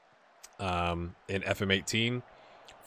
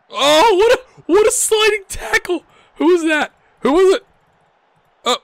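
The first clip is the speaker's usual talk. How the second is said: much higher and louder, yelling like a commentator; excited commentary shout; 11 dB louder than usual. Real, not acted. Vlogger calling a last-ditch tackle live, excited shouting. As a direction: shout the play as it happens.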